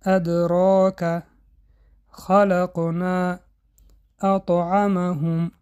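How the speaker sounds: ادراک, خلقنا, اطعمهم slow, with a small mid-word bounce; light qalqala. Shown as a demonstration. Teacher recites the words with minor qalqala for students. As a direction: keep a faint echo on the mid-word letters.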